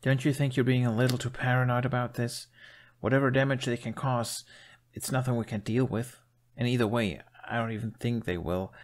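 lightly voiced exasperated youth. A narrator's reading. Hiccup arguing with the chief, lightly exasperated. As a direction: push back, a hint of exasperation. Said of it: "gesticulated exasperatedly".

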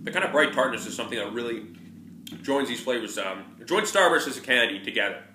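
chewy muffled words; talking while chewing. Real, not acted. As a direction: mouth partly full of candy. Seen 0: Lips pursed, jaw working between phrases; later mentions candy stuck in teeth.

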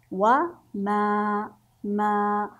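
long drawn-out "ما"; long vowel. Shown as a demonstration. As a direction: hold the vowel of "ما" slow and level. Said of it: "صوت قصير وصوت طويل". ("ما" بصوت طويل ممدود)

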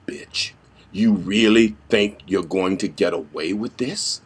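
low, hushed threat sinking into whisper; quiet menace. Shown as a demonstration. Vigilante cornering a young hoodlum, hushed menace. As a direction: intimidate softly, never raising the voice. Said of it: "And of course there's".

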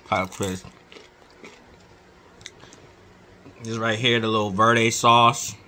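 talking with mouth full; crunching chip mid-sentence; chewing while talking. Real, not acted. Seen 1: Holds chip throughout; bites it at 4.3, chewing through 5.3.

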